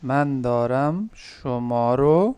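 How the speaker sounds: من دارم شما رو drawn out slowly, word by word; slow careful model. Shown as a demonstration. Teacher echoes the student's phrase, slowly and carefully. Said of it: "teacher repeats slowly".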